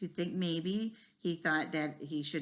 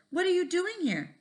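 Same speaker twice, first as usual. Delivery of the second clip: high, startled question; jumpy and caught off guard; 13 semitones higher, 8 dB louder than usual. Acted. Doug is startled by Mary's tap on the shoulder, high and startled. It made me jump.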